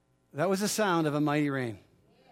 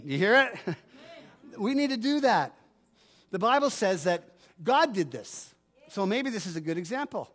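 usual speaking voice

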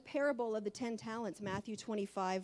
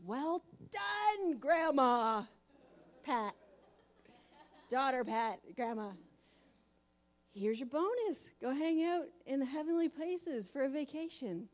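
higher, louder joyful greeting; warm welcome from God; 4 semitones higher, 4 dB louder than usual. Retold or imitated. God welcoming Grandma Pat into heaven, joyful. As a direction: celebrate her arrival in heaven.

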